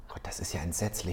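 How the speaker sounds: geflüstert, fassungslos; entsetztes Flüstern. Real, not acted. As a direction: tonlos vor Entsetzen hauchen. Entsetzt über schlimme Frage. (entsetzt flüsternd)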